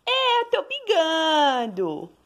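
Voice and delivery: put-on high whiny baby voice with a crying edge; speaking as the baby. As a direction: voice the baby's protest, high and teary.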